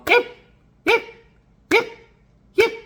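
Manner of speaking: short high imitation yips, evenly spaced; pestering little-dog yips; small dog yipping. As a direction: imitate a tiny dog's sharp yip. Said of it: "And she's going".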